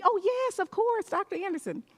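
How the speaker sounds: high-pitched mock enthusiasm; pretended recognition. Guests claiming to know the doctor, gushing pretence. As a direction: bluff knowing somebody, hammed-up and high.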